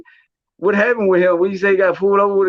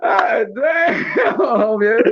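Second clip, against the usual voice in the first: laughing exclamation; 6 semitones higher, much slower than usual. Real, not acted. Laughing outburst.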